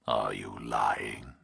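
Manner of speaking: slow, low, menacing whisper; distorted nightmare voice. A narrator's reading. Kidnapper on the phone to Burke, slow eerie whisper. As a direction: breathe it like a monster on the line. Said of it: "The nightmare voice".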